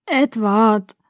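weak but clearer second attempt; slightly clearer voice. A narrator's reading. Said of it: "meine Stimme wurde etwas klarer". (etwas klarer und kräftiger)

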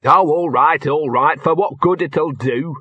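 grudging, put-upon complaint; grudging mutter. A narrator's reading. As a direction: give in with bad grace. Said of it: "muttered Gaspode".

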